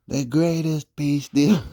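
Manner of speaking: high, loud, slow boast; mock Trump boast. Mocking Trump, a loud slow brag. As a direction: stretch out the brag like a showman.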